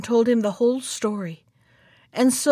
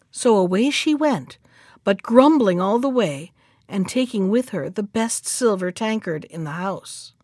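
ordinary delivery, nothing marked

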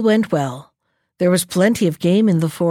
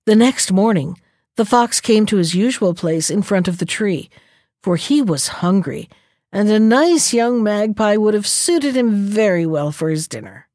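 ordinary delivery

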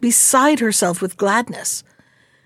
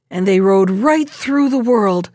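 plain reading voice

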